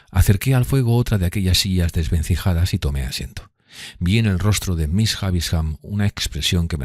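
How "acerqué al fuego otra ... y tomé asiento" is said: ordinary delivery, nothing marked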